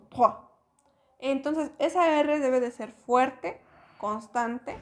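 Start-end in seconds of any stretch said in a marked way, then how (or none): 0.0-0.3 trois with a strong French R. Shown as a demonstration. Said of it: "lo vamos a pronunciar como".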